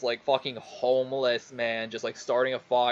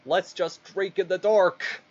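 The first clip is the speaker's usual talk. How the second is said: slower, raised voice for the chief's line; joke punchline delivery; 7 semitones higher than usual. Acted. Chief telling everybody in a joke, mock proclamation. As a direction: draw the punchline out, pitched up.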